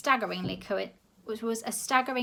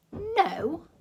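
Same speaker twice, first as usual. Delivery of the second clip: lying; 10 semitones higher, 5 dB louder than usual. A narrator's reading. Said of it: "lied the man".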